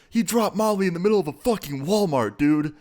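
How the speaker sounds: buzzing high-pitched hype; amped up. Retold or imitated. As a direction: announce it like the best news ever. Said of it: "how amped up Jason was".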